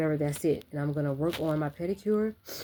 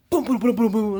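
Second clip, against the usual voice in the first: loud, higher booms mimicking crashing; imitated booming; 6 semitones higher, 9 dB louder than usual. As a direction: boom it out like slams outside. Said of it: "That's what it sounded like".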